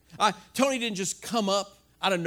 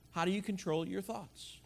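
the same question, much softer; softer repeat; 3 semitones lower, 12 dB quieter than usual. Real, not acted. Pastor repeats the question to the congregation, much softer. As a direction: let it drop to near a hush. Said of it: "So how do you control your thoughts?".

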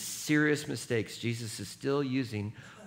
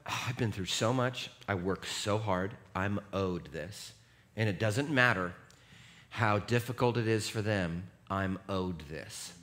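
mock entitlement; 5 semitones lower, 5 dB quieter than usual. Entitled inner voice quoted.

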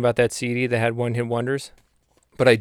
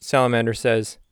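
ordinary talk, nothing marked

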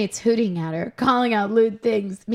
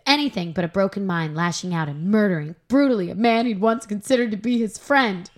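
normal talking voice

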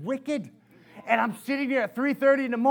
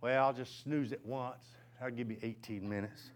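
drowsy thought; 11 semitones lower, 11 dB quieter than usual. Retold or imitated. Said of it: "I was thinking".

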